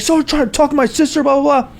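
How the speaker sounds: high-pitched imitation of a furious rant; mock screaming. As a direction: imitate an irate guy yelling. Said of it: "like, screaming".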